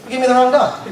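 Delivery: retold protest with a laugh behind it; mock indignation. Preacher retelling his shock at the groomer, mock indignation. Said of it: "and I'm pissed".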